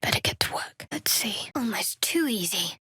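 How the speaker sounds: whisper. Acted. Muttering while searching a container.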